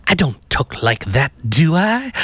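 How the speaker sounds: mock French accent, jumping high on the final question; puzzled French-accented question; Arnaud's French accent. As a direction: imitate a young Frenchman's accent, pitch rising at the end. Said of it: "Arnaud kept saying".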